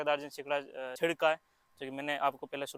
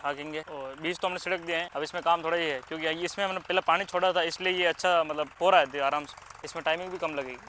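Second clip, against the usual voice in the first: loud, raised voice over engine noise; shouting over tractor; 3 semitones higher, 9 dB louder than usual. Real, not acted. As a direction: call out over the roar of the tractor. Talking over a running tractor. (Tractor के शोर में ऊँची आवाज़ में बोलना)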